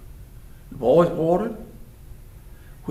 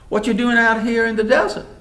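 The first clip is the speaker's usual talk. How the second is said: loud, quick, raised question; brisk, forceful query; pointed questioning; 6 semitones higher, 7 dB louder, faster than usual. Acted. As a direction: demand an explanation, higher and faster. Jethro questions the young stranger.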